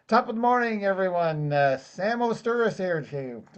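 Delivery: badly faked Irish lilt, starting high; terrible Irish accent. Greeting the networking group as a joke, terrible Irish accent.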